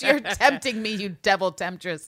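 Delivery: loud, high mock-outraged preacher; slow, hammed-up accusation of a temptress; tempted priest's rant. Host mimics the Hunchback priest accusing Esmeralda, ranting.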